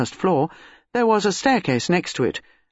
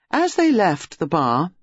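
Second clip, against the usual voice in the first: plain reading voice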